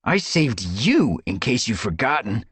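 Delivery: mild indignant retort; sharp retort. A narrator's reading. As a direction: snap back to set the record straight. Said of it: "Brambleclaw retorted".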